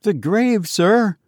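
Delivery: high, meek answer; timid sexton. A narrator's reading. Sexton explaining himself to the goblin, timid and high.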